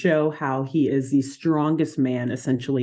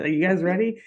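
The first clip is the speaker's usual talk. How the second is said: ordinary talk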